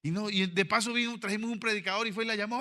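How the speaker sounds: normal talking voice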